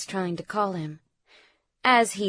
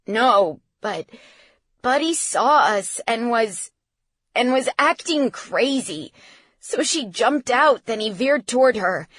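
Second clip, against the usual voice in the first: upset, higher-pitched account; agitated, halting report; fighting a tremor; 3 semitones higher, 4 dB louder than usual. A narrator's reading. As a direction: struggle to explain through panic. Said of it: "struggling to get the tremor out".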